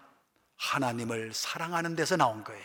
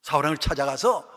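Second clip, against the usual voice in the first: normal talking voice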